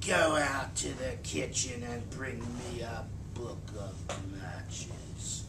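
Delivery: whispered, low and soft, seething; snake-like hiss. A narrator's reading. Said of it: "hissing like a snake".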